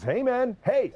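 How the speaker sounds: high, loud call to wake a driver; cop calling out. Retold or imitated. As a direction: shout him awake through the glass. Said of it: "he was rapping that fucking window hard".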